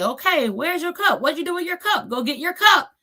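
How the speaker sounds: put-on high singsong child-directed voice; imitated aunt prompting her toddler nephew; talking to toddler. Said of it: "And I say".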